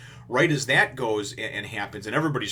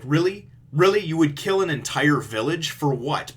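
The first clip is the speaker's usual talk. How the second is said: louder, higher disbelieving questions; incredulous; 6 semitones higher, 6 dB louder than usual. Real, not acted. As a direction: challenge him in outraged disbelief. Incredulous at the villain's cruelty.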